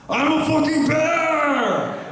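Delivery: high, drawn-out mock bear shout; bear impression. Comic showing his animal impressions, hammed-up high bear shout. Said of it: "Here's my bear".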